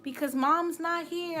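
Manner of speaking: high-pitched mistaken receptionist; imitated office staff. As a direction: mimic a surprised clinic worker. Voicing mistaken doctor's office staff.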